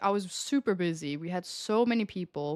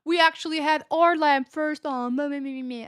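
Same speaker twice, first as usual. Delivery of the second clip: higher-pitched mocking customer voice; complaining customer; 6 semitones higher, 5 dB louder than usual. Waitress mimicking the man at the next table, mocking. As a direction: mimic a fussy guest, trailing into mockery. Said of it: "the man next to the table, he's like".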